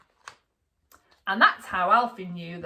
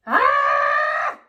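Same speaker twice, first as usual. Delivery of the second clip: high, loud shriek of shock; yelling mum; 8 dB louder than usual. A narrator's reading. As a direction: scream at the crayon on the walls. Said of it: "yelled Mum".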